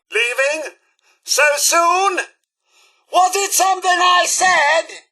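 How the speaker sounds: high, rising robot butler questions; mock wounded protest. Robot to someone leaving, mock wounded protest.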